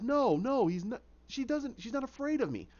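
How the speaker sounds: high, faltering protest; distressed denial. Acted. Jervis insisting she is not afraid, distressed.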